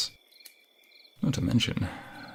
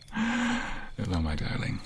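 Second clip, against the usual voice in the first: tender greeting; 13 semitones higher, slower than usual. Acted. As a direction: greet her fondly, sliding down. Greeting lover intimately.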